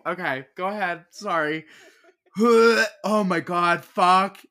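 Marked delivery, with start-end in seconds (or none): none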